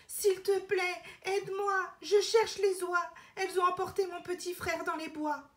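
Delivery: put-on urgent little-girl plea; pleading girl. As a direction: beg like a frightened girl. Elena begs the apple tree.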